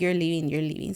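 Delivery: ordinary talk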